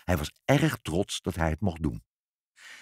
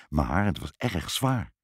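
plain reading voice

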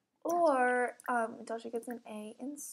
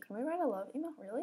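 ordinary talk

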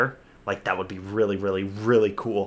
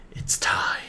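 hushed, whispered countdown moment; dramatic whisper; 4 dB quieter than usual. Acted. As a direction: whisper it with suspense. Hushed echo before playing trailer.

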